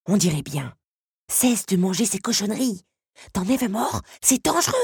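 scolding, pitch climbing as it goes; irritated creature's telling-off; cross warning. A narrator's reading. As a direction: warn urgently, rising to a high final alarm. Scarab angrily warns the girl.

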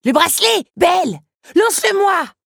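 voix aiguë de scarabée, criée fort; Lucanos qui crie. A narrator's reading. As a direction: crie pour qu'elle lui lance le bracelet. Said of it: "C'est alors que Lucanos lui cria". (Lucanos crie à Belle de lui lancer le bracelet, voix aiguë criée)

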